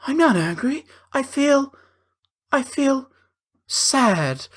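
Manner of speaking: high, whiny mock sadness; mocking the husband. Husband insists during an argument that he's sad, high and whiny. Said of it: "he's like".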